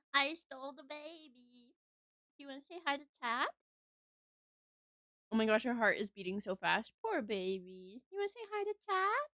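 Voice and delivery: tender soft talk to a pet; cooing softly. Real, not acted. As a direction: hush and coo to a cat on your lap. Cooing to the cat in her lap.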